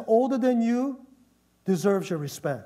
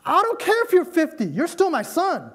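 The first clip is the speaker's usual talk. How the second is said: condescending scolding parent; 9 semitones higher than usual. Retold or imitated. Parent scolding a grown son, condescending. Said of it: "You can be kind of scolding, kind of like you talk down".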